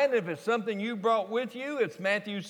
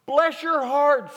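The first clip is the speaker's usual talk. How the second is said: slow, higher, louder Texas "Bless your heart"; 7 semitones higher, 5 dB louder, slower than usual. Shown as a demonstration. Jesus blesses Simon, slow and loud in Texas style. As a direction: stretch out "Bless your heart" loudly like a Texan. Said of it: "New Revised Texas translation".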